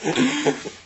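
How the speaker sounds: squeaky real laugh; high burst of laughter. Real, not acted. Reader losing it at the page, high laugh.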